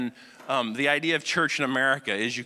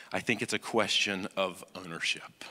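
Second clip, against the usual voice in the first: whispered, confiding the key idea; whispered point; 7 semitones lower, 5 dB quieter than usual. Real, not acted. Preacher telling the congregation his core message, a hushed whisper. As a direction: lean in and whisper it.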